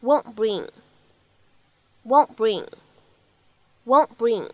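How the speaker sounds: "won't bring" with the t swallowed, wong-bring; linked won't bring. Shown as a demonstration. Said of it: "可以读成". ("won't bring" 失去爆破连读)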